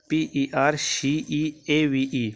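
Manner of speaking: slow, letter by letter; spelling aloud. Shown as a demonstration. Teacher spells the word for students letter by letter. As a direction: spell it out one letter at a time. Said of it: "choose the correctly spelled word".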